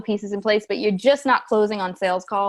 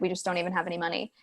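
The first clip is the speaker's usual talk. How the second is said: much softer, quick defeated mumble; quiet defeated quote; 3 semitones lower, 10 dB quieter, faster than usual. Retold or imitated. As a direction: zeg het zacht en snel, moedeloos. Client retold, broke before Christmas.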